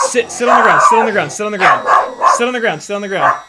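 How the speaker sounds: shouting at Teddy to sit; shouted dog command. Real, not acted. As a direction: drill the dog to sit, loud.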